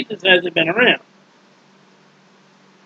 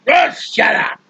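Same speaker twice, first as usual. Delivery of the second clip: higher mock grumble; irritated interruption; 4 semitones higher, slower than usual. Grumpy Jonah to the singing crowd, mock-irritated outburst. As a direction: cut the singers off in irritation.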